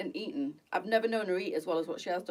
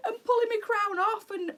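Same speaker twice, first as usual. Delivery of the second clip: laughing; 5 dB louder than usual. Real, not acted. Laughing at toffee eclair mishap.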